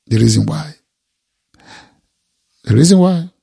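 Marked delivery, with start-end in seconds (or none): 2.6-3.3 higher and louder, pressing the point; louder emphatic repeat. Acted. Preacher driving the point home to the congregation, louder and higher. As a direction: hit it again, harder.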